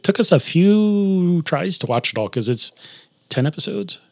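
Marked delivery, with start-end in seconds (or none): none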